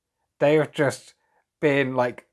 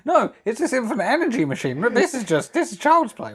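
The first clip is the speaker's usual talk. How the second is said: high-pitched, hammed-up disbelief; mock incredulous; 8 semitones higher than usual. Inventor explaining his real invention is the machine, mock incredulous. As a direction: scoff as if they have missed the obvious.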